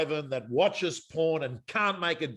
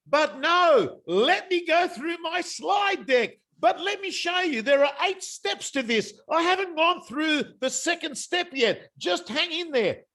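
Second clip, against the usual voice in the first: mocking an amateur agent; 11 semitones higher than usual. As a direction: ham up a clueless agent clinging to his slides. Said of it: "what the amateurs would have done".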